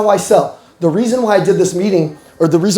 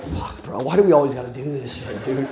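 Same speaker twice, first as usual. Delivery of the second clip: low, soft exhausted grumble; a tired brother's mutter; weary mutter; 6 semitones lower, 8 dB quieter than usual. Retold or imitated. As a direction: sigh it out like a fed-up brother. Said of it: "My brother's like".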